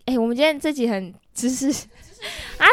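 ordinary talk, nothing marked

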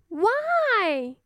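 put-on high little girl asking why; cartoon princess. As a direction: ask like a curious little princess. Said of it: "said the little princess".

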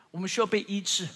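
ordinary talk

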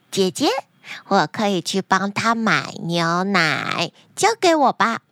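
put-on cheerful child voice with a little giggle; happy little girl. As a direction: voice an eager five-year-old, drawing out her proud words. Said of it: "小慧开心地接下这个任务".

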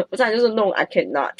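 normal talking voice

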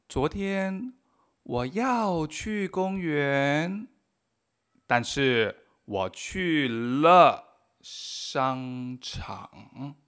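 very slow careful Chinese; slow model sentence. Shown as a demonstration. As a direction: read it word by word. Model sentences read slowly. (frase modelo muy lenta)

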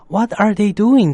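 put-on high, loud child's question; curious child. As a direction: ask it like an eager little boy. Child asks mother in story.

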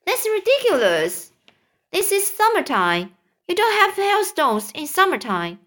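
high, scoffing bug voice; mock the idea as a cocky centipede. Centipede scoffs at James, high and scornful.

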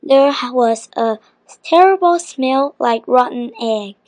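plain reading voice

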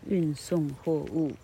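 usual speaking voice

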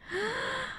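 a sudden high gasp of dismay. Real, not acted. Host gasps at the boss's accusation. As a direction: gasp at the unfairness. A thousand dollars she'd never take.

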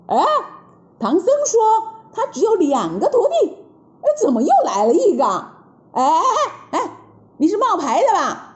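high, scratching-head monster voice; baffled then mocking; puzzled demon. (妖怪挠头嘲笑)

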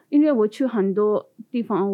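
ordinary talk, nothing marked

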